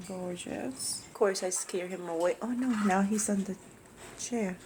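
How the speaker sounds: hushed, so as not to scare the bird; wildlife watching whisper. Real, not acted. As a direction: keep it soft while filming. Filming a bird up close.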